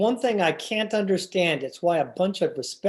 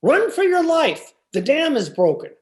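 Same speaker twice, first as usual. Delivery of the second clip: retold stage shout; 8 semitones higher, 4 dB louder than usual. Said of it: "came bounding in and called".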